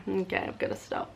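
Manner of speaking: ordinary delivery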